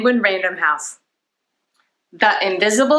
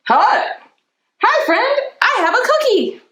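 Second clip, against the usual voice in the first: eager superhero. Acted. A superhero greeting a new friend, high excited. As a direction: bounce with friendliness.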